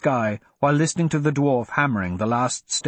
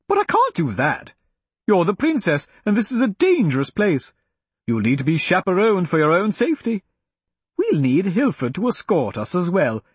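lightly voiced fussy old woman; 5 semitones higher than usual. A narrator's reading. Bernice fussing over the princess.

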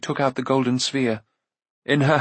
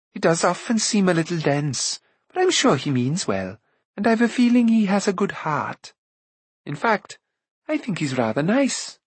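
put-on higher, lighter girl's voice, kindly teasing; spirited girl Eilonwy; 6 semitones higher than usual. A narrator's reading. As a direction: play the bright young princess.

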